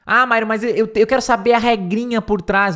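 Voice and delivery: high, loud eager student; pushy student wants the grammar rule; imagined student. Pretend. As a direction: play a student begging for the rule. Imagined student asks for rules.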